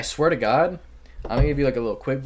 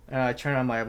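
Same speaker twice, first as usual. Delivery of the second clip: normal talking voice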